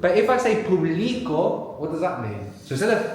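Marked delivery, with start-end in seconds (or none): 0.6-1.8 "publico" as poo-BLEE-ko; middle-syllable stress. Shown as a demonstration. Teacher tests what it means, "publico" stressed on the middle syllable. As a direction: lean hard on BLI in "publico". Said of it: "the accent's very important in Spanish".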